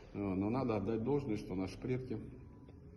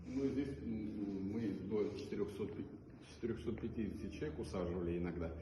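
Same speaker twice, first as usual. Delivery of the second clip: ordinary talk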